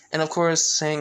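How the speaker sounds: usual speaking voice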